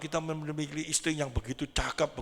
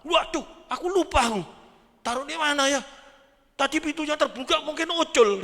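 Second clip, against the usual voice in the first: high, loud, flustered fake alarm; mock panicked husband; 12 semitones higher, 8 dB louder than usual. Husband bluffing to his wife about the lost dog, in a high loud fake panic.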